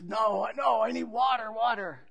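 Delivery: high, pleading croak of a man dying of thirst; desperate thirsty man. Acted. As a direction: beg for water as if your throat is parched. Parched man insisting on water.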